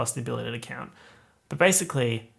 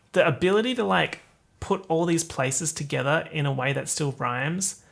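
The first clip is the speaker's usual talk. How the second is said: normal talking voice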